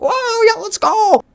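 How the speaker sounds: high, loud whoop; wild celebration. Retold or imitated. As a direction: cheer like kids going apeshit after a heist. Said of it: "going apeshit in the parking lot".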